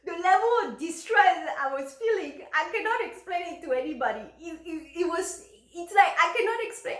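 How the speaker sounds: high, loud, overwhelmed outpouring; worked up and struggling to explain; real agitation. Real, not acted. Recounting own overwhelming distress.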